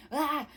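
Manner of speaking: short, high exasperated yelp; mock panicked cry. Wanda unable to find Melanie, mock panicked yelp. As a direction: let out a frantic little 'ah'.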